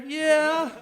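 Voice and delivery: high, loud hype-man yell. Retold or imitated. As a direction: holler it as an imitation. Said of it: "that's my Little John impression right there".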